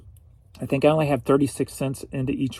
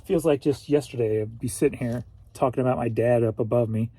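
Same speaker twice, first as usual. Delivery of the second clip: usual speaking voice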